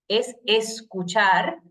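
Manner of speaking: escuchar drawn out slow and firm; stress on escuchar. Shown as a demonstration. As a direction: land slowly on escuchar. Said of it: "lo más importante".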